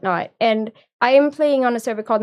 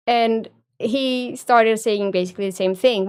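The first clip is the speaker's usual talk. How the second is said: ordinary delivery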